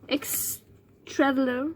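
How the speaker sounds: very slow, halting English with French accent; deliberately bad French accent. Shown as a demonstration. Stumbling over the notebook's English motto, bad French accent. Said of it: "prendre un accent un peu de merde".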